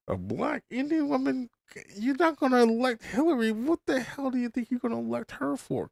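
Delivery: high-pitched, incredulous venting; exasperated rant. Real, not acted. Podcaster venting about the parties' candidate choices, exasperated.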